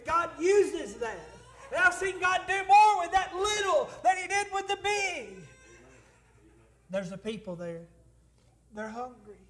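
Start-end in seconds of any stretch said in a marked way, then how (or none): 0.0-5.3 loud, high preacher's shout; full-force preaching. Real, not acted. Pastor testifies what God does with little, preaching at full force. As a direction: build to a ringing shout from the pulpit.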